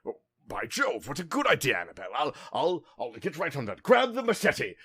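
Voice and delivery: loud, high, hammed-up blustering gentleman; a pompous gentleman eagerly agreeing with Annabelle, blustering loudly; blustery gent. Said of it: "the more, like, blustery voice goes".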